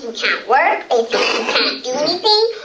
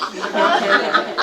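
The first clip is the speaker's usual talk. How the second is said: short laugh; 7 semitones lower than usual. Real, not acted. Laughs after punchline.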